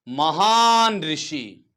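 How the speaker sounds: "महान ऋषि" slowly, as two separate words; slow teaching split of महान ऋषि; drawn-out word split. Shown as a demonstration. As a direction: stretch महान very long, then ऋषि. Teacher splits महर्षि into parts.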